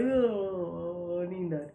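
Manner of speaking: acted bored, sleepy listener. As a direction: groan it out as if nodding off. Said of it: "अगला बोले".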